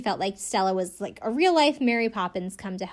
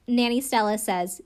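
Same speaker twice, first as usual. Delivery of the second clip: usual speaking voice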